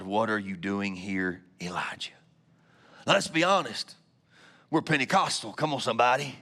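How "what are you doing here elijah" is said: much lower, fading into a whisper. Acted. God whispering to Elijah at the cave. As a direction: breathe God's question like a low whisper. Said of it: "there came a voice to him".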